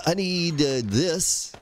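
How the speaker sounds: normal talking voice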